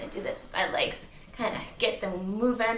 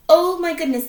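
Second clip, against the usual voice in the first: higher, louder mock gasp; surprised; 6 semitones higher, 10 dB louder than usual. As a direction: act amazed for the kids. Dramatic storytelling surprise.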